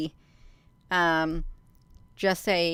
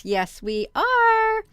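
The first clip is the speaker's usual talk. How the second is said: excited; 13 semitones higher, 4 dB louder, much slower than usual. Real, not acted. Cheering on the screen share.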